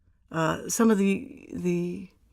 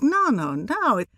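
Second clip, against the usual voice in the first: mock protest; 8 semitones higher, 3 dB louder than usual. Protesting the misspelled name.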